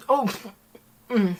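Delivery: ordinary talk, nothing marked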